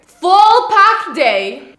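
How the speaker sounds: drawn-out, high and loud hype call; excited announcement. Real, not acted. Vlogger hyping her followers for a packed day, high and loud. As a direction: sell the busy day with a big stretched shout.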